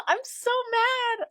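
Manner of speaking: "I'm so mad" in a very high, slow, cartoonish squeak after a laugh; squeaky mock whine. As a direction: squeal "I'm so mad" like a tantrum, tongue in cheek.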